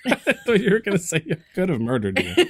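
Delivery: words spoken through laughter; laughing while teasing. Real, not acted. Laughs at own murder joke.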